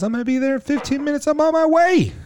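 high, fast, chirpy mock read of the buddy's text; parodying the buddy's upbeat text; mock text message voice. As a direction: squeak it out like an eager friend.

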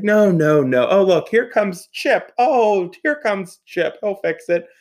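high, loud protest easing into singled-out delight; imitated excited relief. Grandmother spotting Chip coming to help, imitated excitement. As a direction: play an excited grandmother, pitched well up. Acts grandmother greeting her rescuer.

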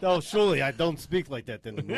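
exaggerated Russian accent; comic broken-English impression, Russian-accented; Mishka. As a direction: protest in a mock Russian accent. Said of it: "us impersonating him with such, like, a poor English accent".

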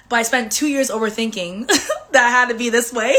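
laughing at herself. Real, not acted. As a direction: laugh through the confession.

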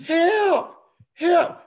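put-on high little girl's voice calling help; child calling for help. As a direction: call out like a stuck little girl. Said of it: "Angie heard somebody".

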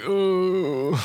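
high delighted ooh; impressed ooh. Retold or imitated. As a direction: coo with surprise. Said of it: "you're just like".

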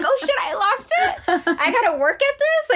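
high, laughing disbelief; laughing mock dismay. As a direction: squeal it in amused disbelief.

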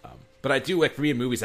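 usual speaking voice

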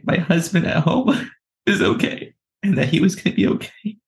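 high, laughing through happy tears; overwhelmed joy, almost crying; tearful joy. Real, not acted. Recalling God's embrace to her listeners, high tearful laughing joy.

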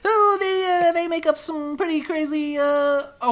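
high, loud, hammed-up character voice; exaggerated high-pitched comic voice; put-on character voice. Shown as a demonstration. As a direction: pitch it way up and play it big. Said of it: "I can do that voice if you want".

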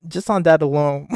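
breaking up with laughter mid-line; laughter spills over the words; laughing. Real, not acted. Pitching the main event, laughing helplessly. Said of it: "I can't stop laughing".

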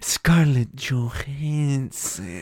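very slow, stretched-out naming; drawn-out teasing name. Pretend. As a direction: savor the name, dragging each syllable.

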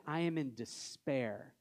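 low, slow mock confession of despair; a slightly heavy, drawn-out imitation of despair. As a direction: play someone admitting despair, slowly. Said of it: "If one of you said".

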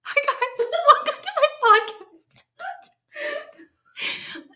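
giggling, pitch up high; laughing. Real, not acted. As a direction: break into giggles mid-greeting. Guest restarts intro, giggling.

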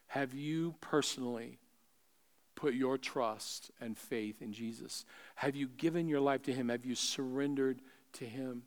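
soft, searching pastoral appeal; quiet altar call. Real, not acted. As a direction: ask softly, leaving room between questions.

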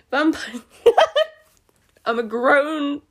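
giggling through the words; can't stop laughing while reading; real helpless giggles. Real, not acted. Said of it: "I'm laughing at this".

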